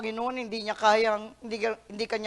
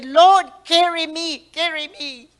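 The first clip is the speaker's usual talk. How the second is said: louder, pleading cry to God; pleading prayer; 7 semitones higher, 12 dB louder, slower than usual. Acted. Preacher voicing a believer's plea for help. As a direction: beg the Lord to carry you.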